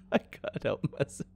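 high, soft squeal through laughter; helpless laughing. Real, not acted. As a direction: squeak it out while giggling. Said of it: "I gotta stop laughing at accents".

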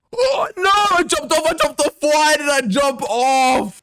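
high, loud furious outburst, last line slowed; raging. Real, not acted. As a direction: yell in real frustration at the fall. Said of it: "The amount of rage inside me".

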